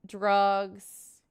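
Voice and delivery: normal talking voice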